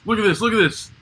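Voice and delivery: eager showing off. Retold or imitated. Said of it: "And he's like".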